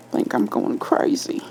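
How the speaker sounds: a cop who doubts his own mind; late-night confession to a colleague, shaken; shaken young officer. A narrator's reading. Officer Liu's voice begins.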